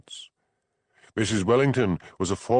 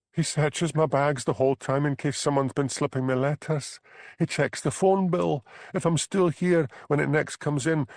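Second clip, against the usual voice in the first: higher, light voice for Bella, desperate; young Highland wife, higher pitched, fed up; 8 semitones higher than usual. Acted. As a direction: play the trapped young wife pleading. Bella's lines in dialogue.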